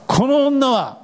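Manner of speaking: scornful, high, pointing at her with disdain; a lightly voiced sneering accuser; contemptuous Pharisee. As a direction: sneer it like a judging scholar. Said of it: "パリサイ人や律法学者なんて言ってました".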